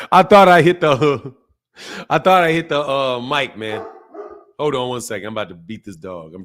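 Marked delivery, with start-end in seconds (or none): 0.0-2.8 laughing embarrassed. Real, not acted. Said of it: "my bad".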